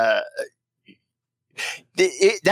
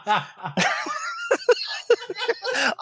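high, long cackling laugh; real laughter; 5 dB quieter than usual. Real, not acted. Host amused by gluten-free confession, real laughter. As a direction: burst out laughing at your own rant.

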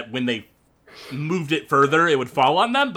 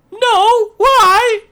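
put-on high, loud old lady squawk; old grandma voice; 10 dB louder than usual. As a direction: chirp it back innocently. Grandma answering orc at door.

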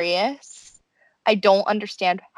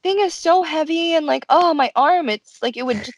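gushing thanks; 8 semitones higher than usual. Retold or imitated. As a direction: gush over the favor, hammed up. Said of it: "Haruka is like".

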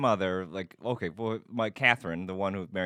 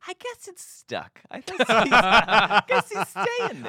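put-on very high, drawn-out old aunt; cartoony grandma; 9 dB louder, slower than usual. Aunt finally giving up on nephew returning, cartoony grandma voice. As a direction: squeak it out slowly like a cartoon grandma. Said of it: "cartoony grandma thing".

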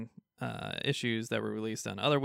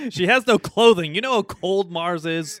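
loud, high mock outrage; mock alarm; 5 semitones higher, 10 dB louder than usual. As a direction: cry out in exaggerated concern. Joins the mock rescue plea.

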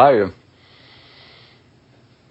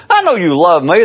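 mock reassurance; 7 semitones higher, 3 dB louder than usual. Dog lover hugging dog for reassurance.

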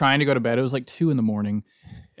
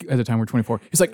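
ordinary delivery

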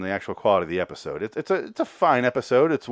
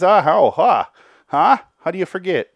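high, loud, incredulous mock outburst; exasperated disbelief; 9 semitones higher, 8 dB louder than usual. Host berating animators over the mirror, hammed-up bafflement. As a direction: demand an answer, voice leaping up.

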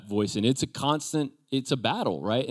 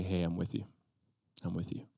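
much softer, lower, tender murmur; soft reassurance; 5 semitones lower, 12 dB quieter than usual. Retold or imitated. Preacher voices God comforting a fearful believer, very soft. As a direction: hush it like comforting a scared child.

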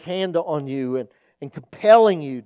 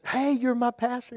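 high, softer mock greeting; surprised greeting; 8 semitones higher, 5 dB quieter than usual. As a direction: imitate a man who just spotted the pastor. Man greets pastor in Walmart.

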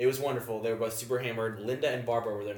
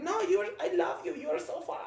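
high, soft sweet gushing; 3 dB quieter than usual. Retold or imitated. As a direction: coo it adoringly. Quoting Linda gushing.

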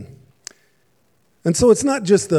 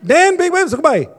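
much higher, louder, strange garbled voice; babbling in a new tongue; 12 semitones higher, 8 dB louder than usual. Retold or imitated. Roger answering Glenn in a confused new language, high and odd. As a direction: sound like you suddenly speak another language. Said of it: "Roger turns and says".

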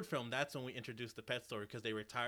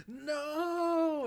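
a much higher, louder despairing no; anguished cry; 14 semitones higher, 6 dB louder than usual. Acted. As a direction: cry out as the dog heads for the car. Flashback, dog walks into car.

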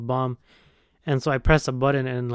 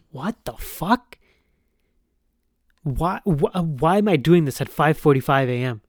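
annoyed disbelief. Retold or imitated. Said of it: "I was so pissed".

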